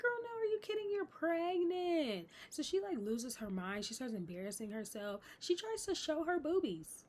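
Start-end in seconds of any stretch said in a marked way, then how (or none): 0.0-2.3 put-on girl, stretch out you're pregnant like she's lost it; incredulous scolding. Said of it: "And I'm like".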